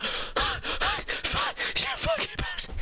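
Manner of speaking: voiceless, drawn-out gasped curse; mock out-of-breath cursing. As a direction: gasp it out between long breaths. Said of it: "and I'm like".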